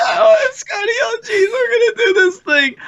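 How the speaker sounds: very high, strained Morty whine. Acted. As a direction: panicky cartoon teen shriek. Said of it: "either that or Morty".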